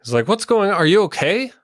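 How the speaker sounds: worried friend calling out high. Acted. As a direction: rush in asking if they're okay. GM voices worried NPC Gilthaniel.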